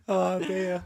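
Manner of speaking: high laughing "oh dear". Real, not acted. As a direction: crack up high, then sigh it. Big laugh at bum joke.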